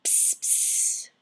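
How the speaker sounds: whispered spitting psst sounds. Acted. The lizard spits out the coffee, hissing. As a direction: spit the coffee out with a hiss. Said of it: "he spat".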